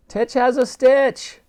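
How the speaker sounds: high, slow called reply; high drawn call; calling back. A narrator's reading. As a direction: answer across the ice. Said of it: "replied Elsie".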